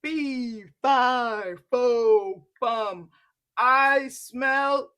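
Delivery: loud, far-off booming giant chant; the giant sniffs and booms his rhyme. Acted. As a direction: boom it out like a huge giant. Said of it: "and said".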